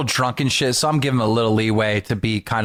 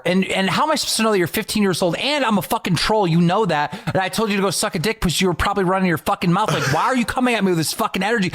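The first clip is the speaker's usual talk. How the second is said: higher, fast retold tirade; telling off a drunk fan in a bar, fast retold rant; mock rant; 7 semitones higher than usual. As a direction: rattle it off like the bar blow-up.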